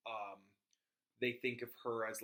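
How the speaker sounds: ordinary delivery, nothing marked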